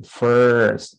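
"first" held on a long "fur" vowel; correct first, drawn out. Shown as a demonstration. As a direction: model "first" clearly as "furrrst". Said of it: "That's the pronunciation".

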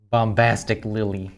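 ordinary delivery

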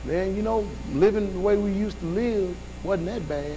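hushed nostalgic sigh of a voice; quiet longing. Retold or imitated. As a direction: murmur it like a homesick backslider. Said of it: "we say".

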